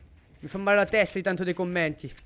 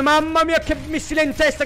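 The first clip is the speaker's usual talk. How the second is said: excited shout; 7 semitones higher, 5 dB louder than usual. Real, not acted. Caster reacts to big hit.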